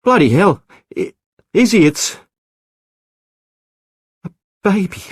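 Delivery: high, loud stunned outburst; amazed cry, BLOODY rising high; astonished shout. Acted. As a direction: shout the discovery to his wife. Tom discovers infant in boat.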